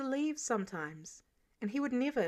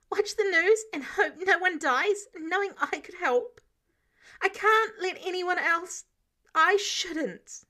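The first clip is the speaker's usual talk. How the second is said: tearful pleading; 7 semitones higher, 5 dB louder than usual. A narrator's reading. Peter pleads, eyes glistening.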